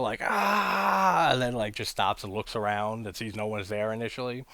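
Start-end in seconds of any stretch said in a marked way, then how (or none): 0.2-1.3 loud high mock battle cry; mock war cry while running in with a chainsaw; imitating a chainsaw yell. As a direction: charge in yelling Ah. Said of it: "running with the chainsaw".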